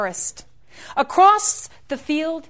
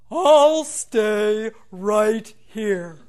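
put-on very slow, louder old tree; deep rich tree voice; 3 semitones lower, 4 dB louder, much slower than usual. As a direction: let each word settle like roots. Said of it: "in a deep, rich voice".

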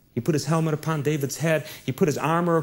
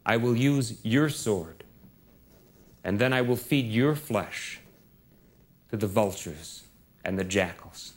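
calm threat; 6 semitones lower, 4 dB quieter than usual. Acted. David threatens Goliath, slow low.

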